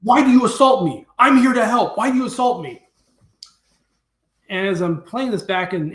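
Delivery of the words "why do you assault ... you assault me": higher, louder outraged protest; indignant protest. Retold or imitated. Steve protesting to the villager, loud and indignant. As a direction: demand an answer, wronged and baffled.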